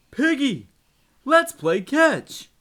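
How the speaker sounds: high, slow friendly elephant voice. Acted. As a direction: invite your friend to play. Elephant voice in story.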